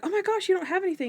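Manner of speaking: high, rushed, fretting quote; imitating someone in a fluster; mock alarm. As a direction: blurt it out high and fast. Acting Sharona's alarm at bingo.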